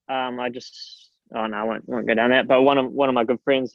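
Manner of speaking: usual speaking voice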